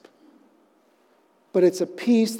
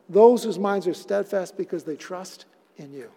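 usual speaking voice

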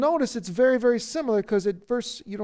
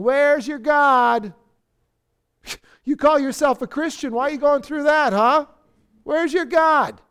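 loud, higher jeering challenge; taunting; 5 semitones higher, 6 dB louder than usual. Acted. Enemy to the suffering believer, loud taunting. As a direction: needle them with mocking questions. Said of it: "it's taunting you".

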